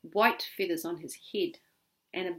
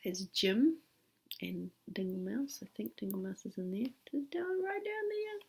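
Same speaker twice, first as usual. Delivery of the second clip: whispered aside; 8 dB quieter than usual. Real, not acted. Reader points at picture aside.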